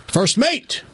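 high call, breaking into laughter; captain calling for brown pants; captain's punchline order. Acted. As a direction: deliver the punchline like a proud captain.

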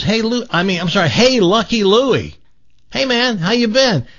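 high-pitched, eager acted greeting; hailing a buddy. Man sees his buddy months later, calling out. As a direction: call across to an old pal. Joke character hails old buddy again.

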